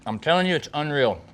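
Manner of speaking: talking through a big bite; words round a mouthful of sandwich; mouth full. Real, not acted. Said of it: "I don't care if my mouth is full".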